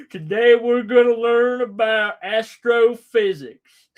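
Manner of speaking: slow, lightly lecturing professor; mock professor. Streamer mocking a professor opening an astrophysics class. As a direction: announce the topic like a lecturer.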